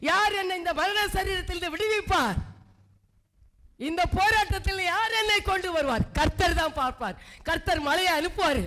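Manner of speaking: a pastor crying out that God alone lifts you up; fervent preaching. Acted. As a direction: preach it out to the whole church. Preaching builds to full force.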